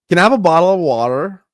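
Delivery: quick American "Can I have a bottle of water?" with a rise; a light American "bottle of water"; American accent. Shown as a demonstration. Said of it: "Bottle of water versus bottle of water".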